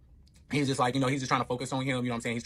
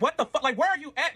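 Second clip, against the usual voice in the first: high, louder frantic demand; retold panic; 11 semitones higher, 4 dB louder than usual. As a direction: reenact panicking on an urgent call. Panicked phone call retold.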